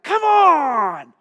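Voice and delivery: loud, high, exploding yell; furious shout. Retold or imitated. To the coworker who knocked his load off, a furious shout. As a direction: blow a gasket at him. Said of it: "I just blew a gasket".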